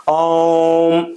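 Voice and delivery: ओम chanted with the vowel held long; prolonged ओम, vowel stretched to triple length. Shown as a demonstration. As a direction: draw out ओम slowly like a chant. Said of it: "जैसे क्या".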